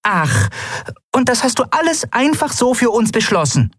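very high, affronted little voice; piqued, high-pitched fairy; indignant challenge. Acted. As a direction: question the decision in a high, offended squeak. Indignant winged friend challenges decision.

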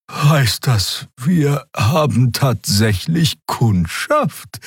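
high, drawn-out, delighted creature voice; beaming. A narrator's reading. Xea hears there are customers, beaming. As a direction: ask it slowly, high and delighted.

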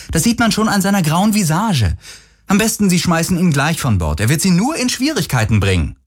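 put-on high-pitched outraged girl; indignant accusation. (empört anklagend, mit hoher Stimme)

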